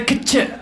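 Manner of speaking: "kitchen" as couch squeezed to k'ch; vowels dropped. Acted. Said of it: "They take out the O and the U".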